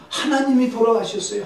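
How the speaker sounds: acted grave announcement. Wife telling Luther shocking news, high acted lament.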